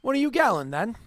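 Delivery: a rough "Oi" called out to strangers; gruff soldier call. Acted. Soldier hailing the two riders, gruff call. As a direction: hail them like a bored guard. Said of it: "one of them looks to you and goes".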